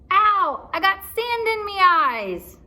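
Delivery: high, loud, slow whine; pirate complaining of sand; whining pirate. Acted. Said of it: "Pinky whined".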